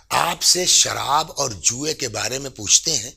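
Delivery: ordinary delivery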